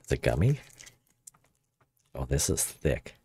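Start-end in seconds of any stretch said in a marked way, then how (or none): none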